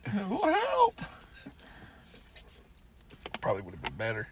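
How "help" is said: mock high cry for help.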